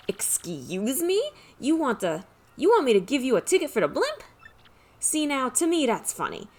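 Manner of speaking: a hint of an Italian mafia boss; mob boss accent. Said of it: "My Italian accent isn't great".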